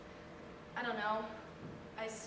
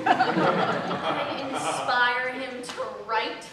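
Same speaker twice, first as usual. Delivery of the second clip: loud, slow incredulous scoff with laughter; scornful disbelief; 5 dB louder, much slower than usual. Acted. Muse scoffing at an MFA student's claim, scornful. Mocking the would-be writer's muse idea.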